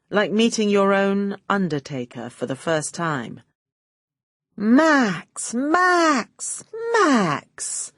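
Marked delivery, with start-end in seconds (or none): 4.6-7.9 high mocking echo of his greeting; sarcastic mimicking welcome; mock reciprocating. A narrator's reading. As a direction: throw his gushing back at him, arch. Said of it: "I reciprocated".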